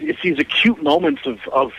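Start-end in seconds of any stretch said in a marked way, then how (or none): none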